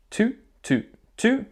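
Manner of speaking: crisp tongued two, two, two, high; articulated two. Shown as a demonstration. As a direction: tap each two with the tongue. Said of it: "I'd like you to repeat after me".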